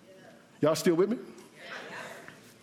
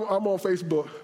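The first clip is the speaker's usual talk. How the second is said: ordinary talk, nothing marked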